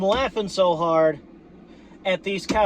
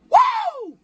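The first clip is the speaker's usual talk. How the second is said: very high joyful woo; party cheer; whoop. Real, not acted. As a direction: let a wild whoop fly.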